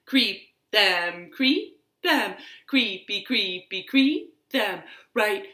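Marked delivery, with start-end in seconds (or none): none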